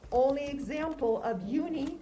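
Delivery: ordinary talk, nothing marked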